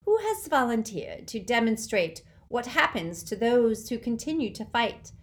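loud, high, sneering dark lord; mock the defeated crowd with loud scorn; Voldemort gloating. A narrator's reading. Dark lord taunting the defenders, loud sneer.

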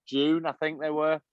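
ordinary delivery

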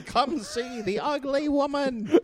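high, slow sideshow barker call; carnival barker. Acted. Husband barking about his ugly wife at the wedding. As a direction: drag it out like a barker at the fair. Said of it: "just carnival barking".

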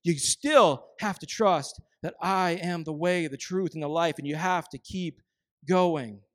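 ordinary talk

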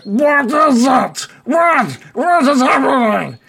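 high, loud goblin demand; goblin boss shouting. Acted. Goblin king on his throne to the runner, shouting.

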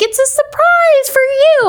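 put-on high, slow, sweet little-kid voice; innocent child voice. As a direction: play the innocent kid pleased with a gift. Child self answering mom's anger.

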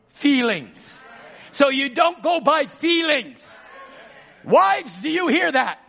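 rising, loud preacher's shout; high and loud, hammering the congregation; preaching at full force. Real, not acted. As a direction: build the point to a fervent shout.

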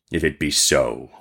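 low, grave genie voice; reasoning genie. Acted. Genie reasons with the merchant, low grave voice. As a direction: lay out the verdict, pitched well down. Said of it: "reasoned the genie".

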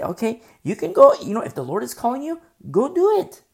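high, loud burst of permission; emphatic go-ahead. Acted. Preacher granting leave to those the Lord calls, emphatic go-ahead. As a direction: urge them on, pitched high. Urging those who are called.

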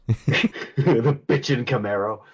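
slow, laughing through the words; savoring the phrase with a laugh. Real, not acted. Host laughing at the comment, slow amused repeat.

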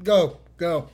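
ordinary talk

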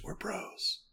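hushed whisper into an ear. Acted. Johnny leaning into Kenshi's ear, whispering. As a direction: whisper it very softly, like a secret. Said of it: "Johnny leans into Kenshi's ear and just whispers".

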